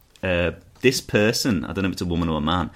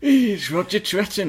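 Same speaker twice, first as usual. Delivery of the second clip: much higher, mock indignant mum; mock posh mum; 12 semitones higher, 5 dB louder, slower than usual. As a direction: squeal it like an outraged mother. Mocking the reviewer's son.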